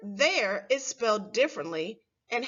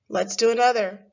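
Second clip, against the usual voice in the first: ordinary delivery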